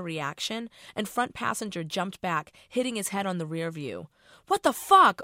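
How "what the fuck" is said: high, loud shocked scream. Acted. Guys in the car screaming at Rose, high and loud. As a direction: shriek it in disgust at the tampon. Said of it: "all the dudes screamed".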